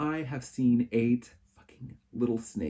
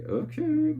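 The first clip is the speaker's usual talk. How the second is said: surprised okay; 5 dB louder than usual. Retold or imitated. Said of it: "And I was like".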